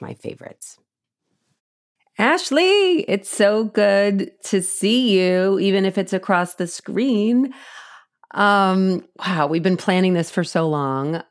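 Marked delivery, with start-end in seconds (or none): 2.2-11.2 excited greeting. Real, not acted. Greeting the guest on screen.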